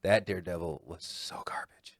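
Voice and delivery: hushed disgusted whisper. Real, not acted. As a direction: whisper it like a shameful secret. Disgusted verdict on bad movie.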